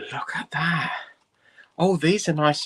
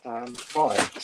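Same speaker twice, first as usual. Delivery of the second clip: ordinary talk, nothing marked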